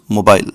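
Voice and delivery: mobile said MOH-bile; British pronunciation. Shown as a demonstration. Showing viewers the British mobile, British pron. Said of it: "British people call this".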